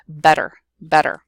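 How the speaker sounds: "better" said bedder, twice, loud and separate. Shown as a demonstration. Teacher proving the D sound, "better" as bedder. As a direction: tap each "better" with a d sound. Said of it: "almost sounds like a D sound".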